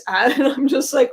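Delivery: usual speaking voice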